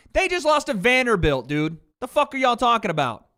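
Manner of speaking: loud, heated retort at chat; yelling at chat for bad takes; angry. Real, not acted. Arguing with chat.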